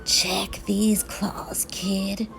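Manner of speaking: slow, hushed rasp; soft, whispery, menacing monster rasp. A narrator's reading. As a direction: rasp it slowly from under the bed. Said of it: "a third voice from under the bed rasped".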